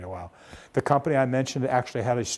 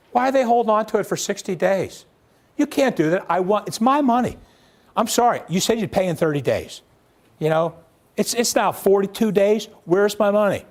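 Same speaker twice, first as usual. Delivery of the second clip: loud, much higher, furious demands for payment; angry collection call; 7 semitones higher, 5 dB louder than usual. Retold or imitated. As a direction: chew out the customer who won't pay. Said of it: "you may not want to have quite that tone".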